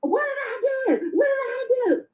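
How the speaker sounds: high, mocking wail of protest; mock whining shout. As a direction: mimic her crying out in protest. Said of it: "You went off".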